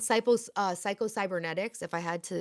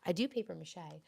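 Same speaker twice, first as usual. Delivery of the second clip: normal talking voice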